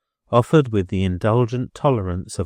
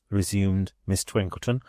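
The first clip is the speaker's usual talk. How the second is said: usual speaking voice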